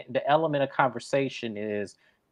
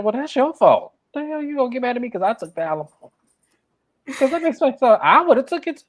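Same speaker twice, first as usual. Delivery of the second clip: higher and fast, sassy reunion clapback; mock Camille read; 10 semitones higher, 4 dB louder, faster than usual. Imitating Camille reading a castmate, high and sassy. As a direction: read her like Camille did.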